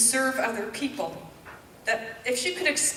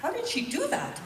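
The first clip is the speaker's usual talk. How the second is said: retold wondering thought; 8 semitones higher, 4 dB quieter than usual. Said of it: "And I'm thinking".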